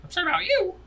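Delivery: high, concerned character voice; high-pitched NPC voice. Acted. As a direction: play the worried guardian up high. GM plays NPC.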